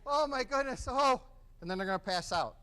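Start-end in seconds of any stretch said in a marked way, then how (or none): 0.1-1.2 sudden very high, loud scream of pain; acted scream. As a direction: scream as the packing goes in. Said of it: "I'm done screaming".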